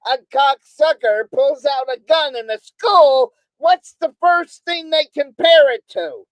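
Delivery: real high, loud exasperation; angry rant. Real, not acted. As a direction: raise your voice in outraged rhetorical questioning. Angry rant about news comparisons.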